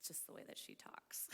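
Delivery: hushed, near-whispered reassurance; confiding aside. Retold or imitated. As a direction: lean in and explain very softly. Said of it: "And he leans over".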